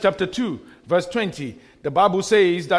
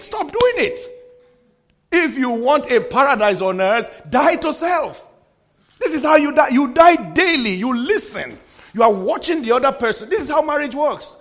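raised pitch, forceful exhortation; impassioned preaching; 5 semitones higher, 4 dB louder than usual. Real, not acted. Pastor urging couples to listen to each other, impassioned preaching. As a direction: drive it home to the whole congregation.

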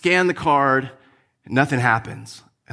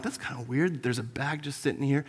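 much softer, wondering under the breath; quiet puzzlement; 2 semitones lower, 11 dB quieter than usual. Retold or imitated. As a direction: muse to yourself over a strange bag, much softer. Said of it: "I'm like".

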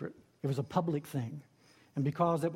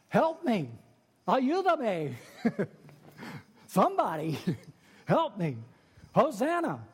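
high pleading cry, louder; desperate cry for help; 5 semitones higher, 4 dB louder than usual. Acted. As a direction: cry out like someone going under. Preacher acting a desperate cry.

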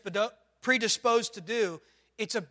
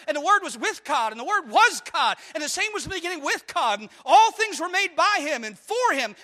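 higher, louder, fervent preaching; full-force preaching; 8 semitones higher, 5 dB louder than usual. Acted. Pastor preaching to the congregation about the one God, fervent and loud. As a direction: preach it out to the whole church.

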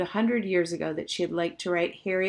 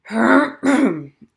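loud gruff throat clear; 6 semitones higher, 11 dB louder than usual. Acted. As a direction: clear the throat awkwardly, twice over. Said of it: "cleared his throat a couple of times".

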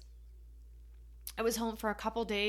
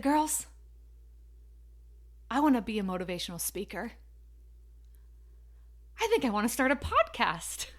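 blurted out; faster than usual. Retold or imitated. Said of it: "blurted out my big goal".

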